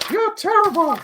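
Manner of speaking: high-pitched, loud cartoon mouse; mock insult in a squeaky falsetto; Mickey Mouse. Shown as a demonstration. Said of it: "Change the voice to Mickey Mouse's voice".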